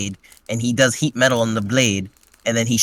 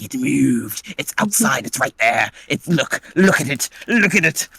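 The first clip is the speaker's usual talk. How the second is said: insistent. Retold or imitated. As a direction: push harder on each look at it. Malik insists, pointing outside.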